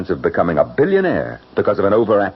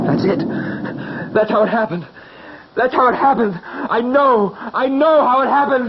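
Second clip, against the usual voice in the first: excited realization. Acted. Claude yelling out in the storm.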